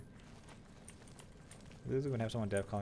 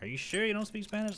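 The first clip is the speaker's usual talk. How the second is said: doubtful caller; 12 semitones higher than usual. Retold or imitated.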